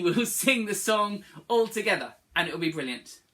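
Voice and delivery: ordinary talk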